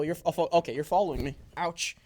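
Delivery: usual speaking voice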